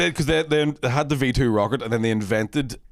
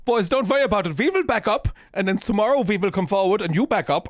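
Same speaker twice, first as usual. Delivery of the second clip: higher-pitched mock-German commander; German accent; 5 semitones higher than usual. German commander proposes a truce to his boys, in a German accent. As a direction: put on a comic German accent. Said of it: "the German guy in charge".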